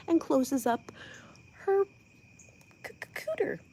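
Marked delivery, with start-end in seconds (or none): 2.9-3.6 stammered, whispered and high, unsure she may say it; hesitant whisper. Real, not acted. Nervously whispering the rude word to her viewers. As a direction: whisper the risky word cooter, stuttering on c-, c-. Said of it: "I don't know if I'm allowed to say that".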